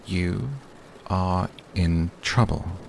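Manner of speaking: every word pressed out slowly; "You are in trouble" with each word weighted; slow, deliberate warning; slow and emphatic. A narrator's reading. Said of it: "I said slowly, emphasizing each word".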